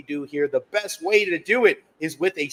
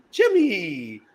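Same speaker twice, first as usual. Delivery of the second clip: put-on British accent; 3 semitones higher than usual. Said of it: "with the British accent".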